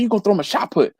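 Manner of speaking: retold insistent Southern coach. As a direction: imitate a Southern coach handing down the verdict. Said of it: "he was real Southern".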